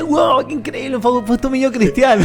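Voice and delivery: high, mock amazed gushing; amazed fans. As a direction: gush like an impressed fan. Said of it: "la gente dice".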